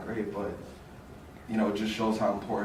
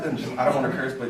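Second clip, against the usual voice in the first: ordinary delivery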